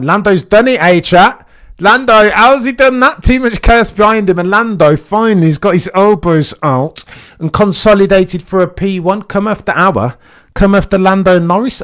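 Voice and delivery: hyped commentary. Real, not acted. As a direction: celebrate Lando taking the lead. Hyped commentary on Lando leading.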